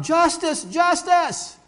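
high, loud cry for justice. Retold or imitated. Condemned lawyer crying out at the guillotine, loud and high. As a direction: cry out from under the blade. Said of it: "And he cries out".

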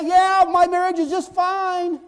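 high, loud, slow put-on voice; mock carefree denial. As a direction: drawl out a fake all-is-well. Acted denial of a crisis.

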